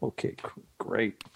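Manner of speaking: whispered, dismayed okay great; stunned whisper. Retold or imitated. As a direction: breathe it out in shock. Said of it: "I'm like".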